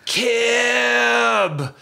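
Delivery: long, high, drawn-out call; high wailed name, stretched out; ghostly voicemail cry. Retold or imitated. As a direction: imitate an eerie cry, holding the vowel high.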